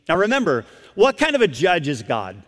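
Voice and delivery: usual speaking voice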